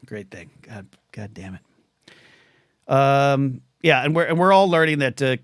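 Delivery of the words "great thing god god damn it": very soft, slow, close to tears; choked up. Real, not acted. Host thanking generous fans, voice breaking softly. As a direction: let the words break off as tears come. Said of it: "I'm gonna cry".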